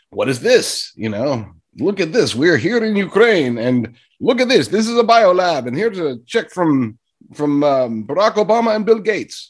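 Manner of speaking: hammed-up mock Russian accent; terrible Russian accent. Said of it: "that wasn't even close to a Russian accent".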